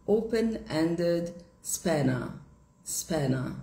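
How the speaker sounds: teacher models British ending; British pronunciation. Shown as a demonstration. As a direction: say open-ended spanner, British style. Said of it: "no inglês britânico é falado como a".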